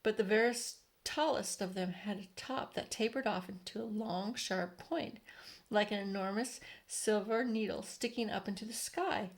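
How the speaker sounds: usual speaking voice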